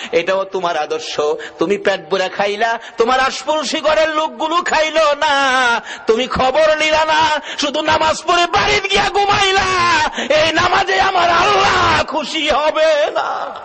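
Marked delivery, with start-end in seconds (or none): 3.0-13.6 high, mounting sermon rebuke; full-force preaching. Real, not acted. Preacher scolds worshippers who ignore hungry neighbours, full-force preaching. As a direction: rebuke the congregation in a high, shouted chant.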